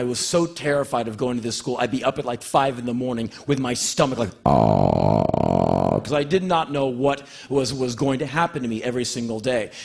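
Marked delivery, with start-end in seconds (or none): 4.4-6.0 deep queasy groan of dread; low anxious groan. Retold or imitated. Boy dreading school at dawn, low anxious groan. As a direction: groan like a knotted stomach. Stomach in fear imitated.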